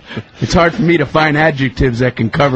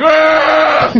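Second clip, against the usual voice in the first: mock moan contest; 13 semitones higher than usual. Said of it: "I'm like".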